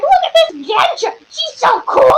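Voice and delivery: high, excited gushing; gushing fan. Acted. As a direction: squeal it like an adoring fan. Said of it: "I'm like".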